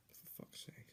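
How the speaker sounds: soft exasperated whisper; whispered curse. Real, not acted. Cursing himself over a mistake, whispered. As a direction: hiss it under your breath. Frustrated at his own mistake.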